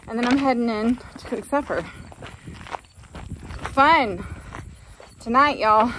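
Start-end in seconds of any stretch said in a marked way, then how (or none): none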